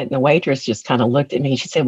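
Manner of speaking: ordinary talk, nothing marked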